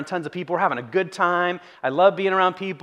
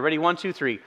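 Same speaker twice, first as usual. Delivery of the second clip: ordinary delivery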